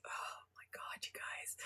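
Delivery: hushed aside to the chat; whisper. Real, not acted. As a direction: breathe it as a shocked secret. Aside to the viewers.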